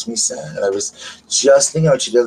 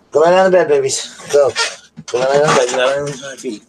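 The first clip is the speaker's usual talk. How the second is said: louder and higher, shooing command; calling to the dogs; 4 semitones higher, 4 dB louder, faster than usual. Real, not acted. Shooing her dogs out from under her feet, loud and high. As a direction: send the dogs off to bed.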